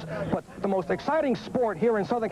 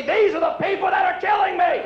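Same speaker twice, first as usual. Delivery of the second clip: exasperated shout; 11 semitones higher than usual. Retold or imitated. Comic yelling back at the insurance salesman, exasperated. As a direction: yell it at the salesman. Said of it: "I say".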